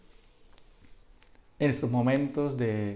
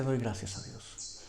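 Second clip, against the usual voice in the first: soft, slow quote; 2 semitones lower, 8 dB quieter than usual. Acted. Quoting the apostle, softened.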